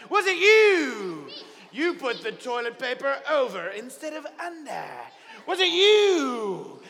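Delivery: hammered-up sailors grilling everyone on board; accusing sailors. Acted. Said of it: "So it says".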